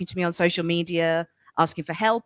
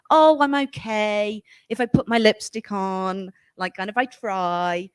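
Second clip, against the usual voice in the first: mock timid modest woman; slower than usual. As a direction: shrink yourself apologetically, mocking it. Mocking modest woman's excuse.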